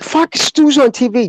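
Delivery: high, slow cry of surprise; startled outburst. Retold or imitated. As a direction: blurt it out, shocked to see him on TV. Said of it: "I'm like".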